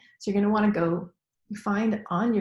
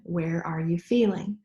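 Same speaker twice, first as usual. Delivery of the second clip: ordinary delivery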